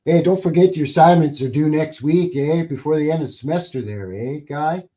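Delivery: folksy Canadian twang, eh at every line end; Canadian accent. Retold or imitated. Podcaster mimicking a casual Canadian university professor, Canadian accent. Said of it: "a Canadian, uh, university professor would easily just be like".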